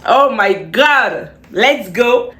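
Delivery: ordinary talk, nothing marked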